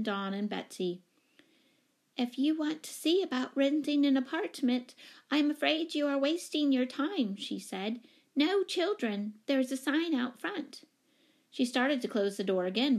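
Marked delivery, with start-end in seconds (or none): 2.2-7.4 high-pitched, apologetic brush-off; timid lady tenant. Acted. Wary tenant turning away a mother, timid and high. 8.4-10.8 high-pitched refusal; timid lady tenant. Acted. Wary tenant refusing a family, timid and high.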